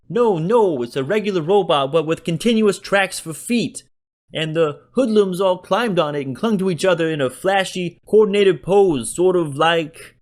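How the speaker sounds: put-on high, loud, whining city accent; whiny city shopkeeper. A narrator's reading. Joe describes the hooligans to Berkeley, whining. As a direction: whine it out like a put-upon shopkeeper. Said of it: "Joe whined in his thick city accent".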